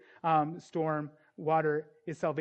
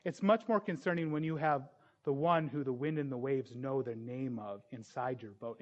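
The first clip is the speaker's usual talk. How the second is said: ordinary delivery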